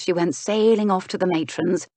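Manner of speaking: ordinary delivery, nothing marked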